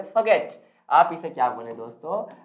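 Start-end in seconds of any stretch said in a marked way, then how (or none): 0.0-0.5 forget as fuh-GET, no r; recommended pronunciation. Shown as a demonstration. Teacher advising what to say, recommended pronunciation. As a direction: leave the r out of forget, weak first vowel. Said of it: "आप इसे बोले forget".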